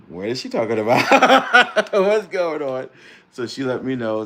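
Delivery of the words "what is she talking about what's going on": loud, high, laughing "What's going on?"; laughing disbelief. Retold or imitated. Disbelief at being called married.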